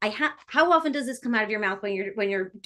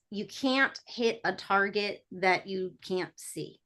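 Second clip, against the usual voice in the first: slow, soft and deliberate; measured aphorism; 3 semitones lower, 6 dB quieter, slower than usual. Acted. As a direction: quote it quietly like a piece of wisdom, taking your time. Quoting a saying for effect.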